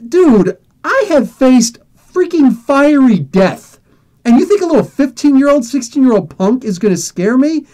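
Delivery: incredulous tough-guy comeback; indignant retort. Retold or imitated. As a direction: put a cocky kid in his place. Acted indignant retort to the kid.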